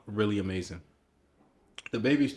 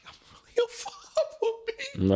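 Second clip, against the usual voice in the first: awed whisper. Retold or imitated. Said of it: "I was like".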